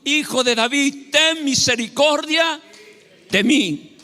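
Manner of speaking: slow, high, drawn-out plea; insistent cry. Acted. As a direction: call it out slowly, stretching each phrase. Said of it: "él vino y nuevamente dijo".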